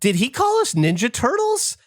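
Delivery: high, anxious voicing of his wife; worried wife. Wife asking if they were mocked, high worried voice. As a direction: sound hurt and unsure. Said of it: "She goes".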